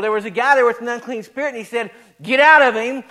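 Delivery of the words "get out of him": loud, punched order; retold exorcism command, thrown out hard; forceful command. Jesus orders the unclean spirit out of a man, loud command.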